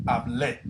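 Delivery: hablé with the stress on the final é; Spanish conjugation. Shown as a demonstration. Teacher writing endings, modelling hablé in Spanish. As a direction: land on the accented é of hablé. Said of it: "E with an accent".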